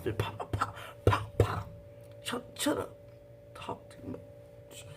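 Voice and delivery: unvoiced pops then hushed shut up; whispered mock slap. Slapping the wife in fantasy, whispered. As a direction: hiss the slap fantasy under your breath.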